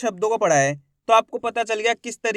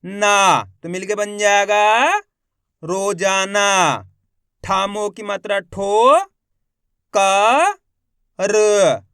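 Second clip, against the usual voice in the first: loud syllable reading drill; 5 dB louder, much slower than usual. Shown as a demonstration.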